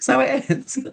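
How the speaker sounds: high-pitched, laughing discovery; amused realisation. Retold or imitated. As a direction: squeak it out through laughter. Retold sudden realisation, laughing.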